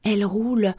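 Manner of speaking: ordinary delivery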